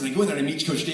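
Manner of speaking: normal talking voice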